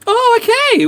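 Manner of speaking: high, loud surprised oh okay. Real, not acted. Streamer reacting to a chat message, surprised. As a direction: caught off guard by chat.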